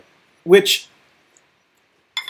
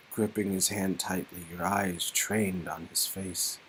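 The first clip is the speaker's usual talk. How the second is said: much softer, lower narration; hushed narration; 12 semitones lower, 20 dB quieter than usual. A narrator's reading. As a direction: narrate the tears tenderly and low.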